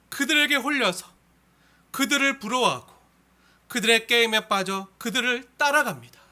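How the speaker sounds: emphatic, declaimed psalm reading; scripture reading. Acted. Reads the psalm aloud to congregation. (힘주어 낭독하는 성경 봉독)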